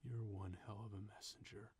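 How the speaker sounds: very soft, hushed quote; Kevin Conroy heartbreaking. Retold or imitated. Host imitating Batman's heartbreaking line, hushed. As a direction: imitate a quiet, heartbroken thanks. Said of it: "line delivery ... was just heartbreaking".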